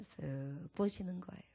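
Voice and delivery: ordinary delivery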